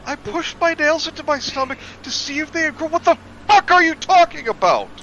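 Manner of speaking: very high, shrill outraged disbelief; shocked shriek. Real, not acted. Friend reacting to the absurd story line, high screech. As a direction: screech it in mock horror.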